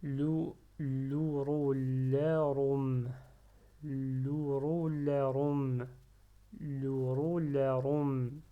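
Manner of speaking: "louroularum" read slowly in one connected flow; slow but fluid. Shown as a demonstration. Teacher seeking fluidity in reading, slow and linked. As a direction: draw out Louroularum, syllables linked smoothly. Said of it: "je cherche la fluidité".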